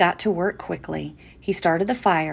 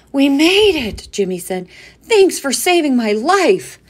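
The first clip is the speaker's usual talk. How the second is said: loud, breathless relief; relieved; 8 semitones higher, 10 dB louder than usual. A narrator's reading. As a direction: gasp out the relief. Relief after landing.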